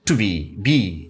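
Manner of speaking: "to be, be" with BE drawn out and falling; stress on BE. Shown as a demonstration. Teacher showing the stressed second syllable, "to be" stressed on BE. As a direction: lean on each "be", keep "to" short. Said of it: "second সিলেবেলে stress পড়ছে".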